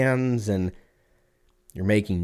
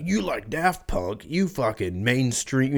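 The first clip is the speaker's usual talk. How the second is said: slow, higher sneering music snob; mock music snob; 3 semitones higher, slower than usual. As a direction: drag it out like a scornful snob. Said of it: "And they're like".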